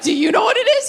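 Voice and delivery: high, wary question to dad; high-pitched, laughing deflection; teen self deflecting. Retold or imitated. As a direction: turn the question back on him.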